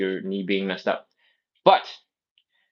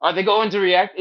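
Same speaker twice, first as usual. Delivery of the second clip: repeated high nervous fret; worried onlooker; 9 semitones higher, 3 dB louder than usual. Retold or imitated. Onlookers watching a baby's needle, fretting high and anxious. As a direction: ask it again, anxious and high. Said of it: "everybody else is like".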